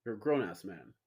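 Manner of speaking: low, soft deadpan reproach; deadpan mock disapproval; mock scolding. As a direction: scold him dryly like a disappointed elder. Repeats scolding.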